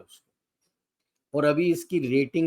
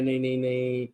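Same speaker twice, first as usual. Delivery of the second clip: lower, softer mock of a nervous official saying "नहीं, नहीं, नहीं"; mock State Department denial; 4 semitones lower, 4 dB quieter than usual. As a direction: lightly imitate a flustered diplomat brushing it off. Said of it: "तो वो state department बोल रहा है".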